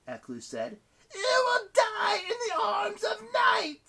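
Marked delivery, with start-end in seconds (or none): 1.1-3.8 high, slow monster screech; drawn-out, high-pitched villain shriek; menacing goddess. A narrator's reading. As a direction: wail the death sentence slowly. Goddess pronounces doom.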